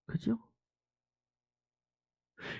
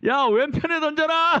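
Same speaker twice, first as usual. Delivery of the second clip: calling out; 9 semitones higher, 5 dB louder than usual. Acted. Jesus commands from shore.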